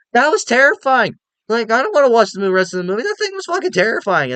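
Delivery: high, loud panicked retelling; scared kid protest. Retold or imitated. To his dad after the chest burster scene, high panicked protest. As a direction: squeal it like the terrified kid who ran out. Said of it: "I'm like".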